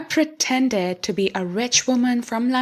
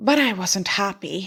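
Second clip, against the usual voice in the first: usual speaking voice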